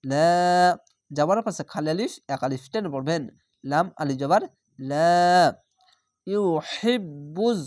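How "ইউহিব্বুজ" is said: ইউহিব্বুজ intoned slowly, joined to the next word; Quranic recitation. Shown as a demonstration. Teacher recites for students, slow chant. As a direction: chant it slowly as one flowing phrase. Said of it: "জঙে মিলে যাচ্ছে".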